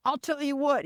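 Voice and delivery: high-pitched imitation of an earnest grandson; funny grandson. Grandson backs his grandmother after the stop, earnest. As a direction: pitch it up, playfully declaring. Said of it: "my grandson was so funny".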